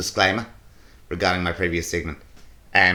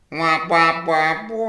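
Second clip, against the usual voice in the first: high, whiny mock crying noise; mocking wah wah; 8 semitones higher, 4 dB louder, much slower than usual. Mocking the complaining women with high wah wahs. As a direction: mimic a whining woman, pitched up.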